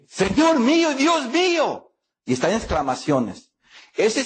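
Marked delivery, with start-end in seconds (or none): 0.1-1.9 exclaimed in awe. Shown as a demonstration. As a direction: exclaim it like Thomas seeing the risen Lord.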